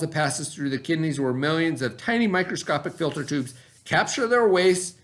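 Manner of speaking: ordinary talk, nothing marked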